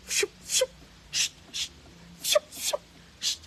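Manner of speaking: put-on loud forced shushing of a beaten child; mock hushing. Mocking the parent who hits the child, loud hushing. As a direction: shush hard over the crying child. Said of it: "on fouette, on dit chut".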